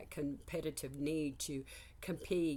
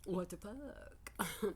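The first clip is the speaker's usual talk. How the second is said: quiet exasperation; 3 semitones higher, 6 dB quieter, slower than usual. Retold or imitated. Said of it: "I was like".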